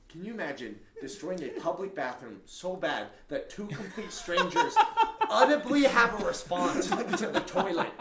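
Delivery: high, loud, laughing through it; laughing retelling. Retold or imitated. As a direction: crack up while quoting. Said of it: "he's like".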